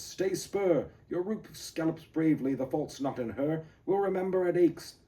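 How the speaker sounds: high, loud urgent plea; urgent rider's call; urgent cry. Acted. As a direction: urge the horse on, crying out. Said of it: "Dirk's cry in the poem".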